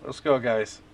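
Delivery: usual speaking voice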